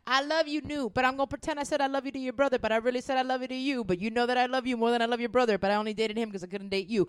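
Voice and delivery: fast, breathless mock confession; hurried parody of a love scene; rushed mock confession. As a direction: rattle it off like a flustered lover. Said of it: "it's like".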